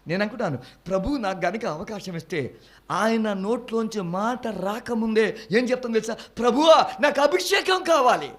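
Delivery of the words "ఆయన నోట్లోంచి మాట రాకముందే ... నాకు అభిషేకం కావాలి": loud, high, fervent preaching. Acted. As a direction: preach it out to the congregation. Preaching returns, fervent cry. (గట్టిగా ఉత్సాహంగా ప్రసంగం)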